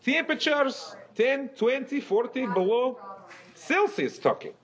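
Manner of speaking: put-on high, heavy Russian accent. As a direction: boast like a gruff Russian. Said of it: "your Russian accent".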